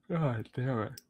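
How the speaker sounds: yawning while talking; words stretched by a yawn; mid-yawn. Real, not acted. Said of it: "I don't know why I'm yawning so much".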